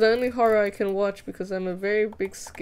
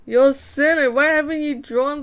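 mock scolding; 6 semitones higher, 8 dB louder than usual. Scolding chat playfully.

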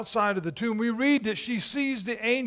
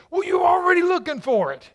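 high, loud, fast call-out; sudden fast preaching shout; 9 semitones higher, 6 dB louder, faster than usual. Real, not acted. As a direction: drive the point home at full voice. Preacher builds to a climax.

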